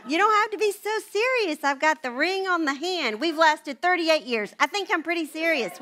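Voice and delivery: high, loud comic self-defence; playfully outraged, playing to the room; mock indignation. Comic defence of wedding behaviour.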